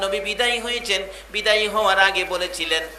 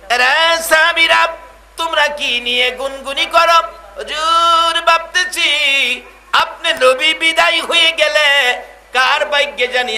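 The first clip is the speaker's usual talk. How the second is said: high, raised voice in a sermon dialogue; acted calling out; 8 semitones higher, 6 dB louder than usual. Prophet questioning murmuring companions, loud acted calling. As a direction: hail them loudly, then keep the reply pitched up.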